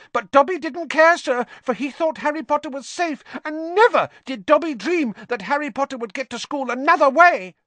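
high, squeaky elf voice, pleading; a fretting elf begging Harry to understand; squeaky elf, reproachful and worried; anxious house elf. Acted. Elf voice held.

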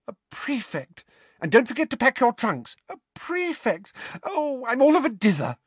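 high, fluttery doting mother; flustered proud mother. A narrator's reading. Mother leaving her newly made prefect son, flustered and proud.

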